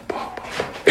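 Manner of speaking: whispered "πα, πα, πα", very soft; hushed tut-tut of alarm; dismayed whisper. Retold or imitated. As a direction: breathe it out in dismay, barely voiced. Priest reacts to screaming man.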